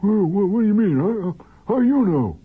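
high, loud, stammering disbelief; flustered alarm. Acted. Flustered man learning his secret is out, high startled alarm. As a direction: blurt it out, startled and rising. Acted exchange, flustered character caught out.

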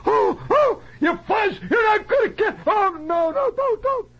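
high, hammed-up tearful yelling; condemned man's pleading. Condemned movie prisoner walking the last mile, wailing high in terror. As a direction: wail and beg in mock movie terror. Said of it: "bring him down that last mile".

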